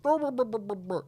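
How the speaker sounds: high mocking brr sounds; dismissive brr noise. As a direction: buzz them off like a buzzer. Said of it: "the boys are like".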